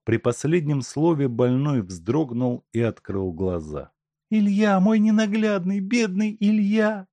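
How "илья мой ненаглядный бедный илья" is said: moaning, sobbing. A narrator's reading. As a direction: moan his name in grief. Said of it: "застонала Елена Никитишна, рыдая".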